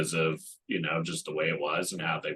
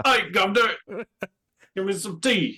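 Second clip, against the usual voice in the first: high, loud cartoon British accent; 12 semitones higher, 7 dB louder than usual. Shown as a demonstration. Co-host showing off his best accent, loud mock British. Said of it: "get your best British accent out".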